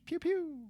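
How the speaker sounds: high "pew" shots dropping in pitch; playful mouth-made laser fire; Star Wars blaster noise. Pretend. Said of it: "It's Star Wars".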